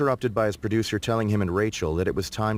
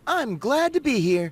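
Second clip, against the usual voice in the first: Rachel voice; 12 semitones higher than usual. A narrator's reading. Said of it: "she said".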